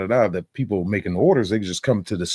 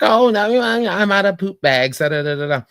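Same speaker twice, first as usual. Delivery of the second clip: higher, louder mock Karen voice, airy excuse; snooty Karen; 13 semitones higher, 6 dB louder than usual. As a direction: toss off the excuse like an entitled neighbor. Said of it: "It's like".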